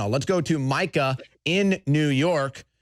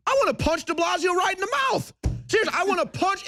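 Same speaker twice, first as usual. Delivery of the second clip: angry outburst; 14 semitones higher than usual. Real, not acted. Host rants about punching the mayor.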